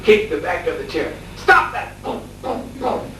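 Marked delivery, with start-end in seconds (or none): 1.4-2.0 high-pitched mock yell; imitated shouted order. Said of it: "he would shout". 2.0-3.1 soft, voiceless "boom" sound effects; imitated kick thuds. Imitates kicks on the chair.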